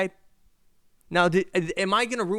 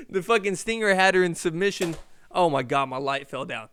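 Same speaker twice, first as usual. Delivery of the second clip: ordinary delivery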